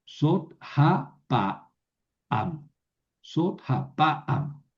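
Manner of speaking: very slow, syllable by syllable; carefully re-read sot ha pa am slowly; slow corrected reading. Shown as a demonstration. Teacher corrects herself and re-reads slowly. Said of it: "perdón".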